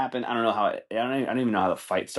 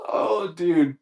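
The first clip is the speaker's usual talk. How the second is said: high, louder, cracking with laughter; laughing disbelief; 9 semitones higher, 4 dB louder than usual. Real, not acted. Podcaster reacting to the umpire clip, laughing high and loud.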